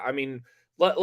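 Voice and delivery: usual speaking voice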